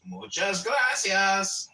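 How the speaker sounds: drawn-out mock Mexican thanks.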